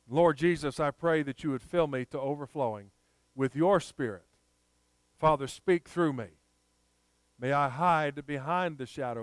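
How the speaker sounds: humbly petition God on the congregation's behalf; earnest prayer. Real, not acted. Leads an opening prayer.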